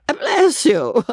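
put-on high weepy drunken wail; tearful drunk. A narrator's reading. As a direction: blubber it out tearfully. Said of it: "weeping pitifully".

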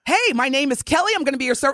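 high, bright waitress greeting; bubbly server voice. Retold or imitated. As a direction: greet the table like a peppy server. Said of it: "That server will come over".

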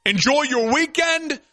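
upbeat radio sign-off promo; show sign-off. Acted. Host wrapping up the Saturday show, upbeat promo. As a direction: sell the weekend lineup with energy.

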